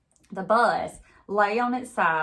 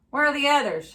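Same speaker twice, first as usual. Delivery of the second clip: loud, higher demand; sheriff demanding; 8 semitones higher, 7 dB louder, faster than usual. A narrator's reading. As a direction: bark the question like a sheriff. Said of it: "he said".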